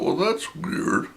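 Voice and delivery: high, whispered, slow puzzled thought; whispered puzzled aside. Retold or imitated. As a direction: muse it half under your breath, baffled.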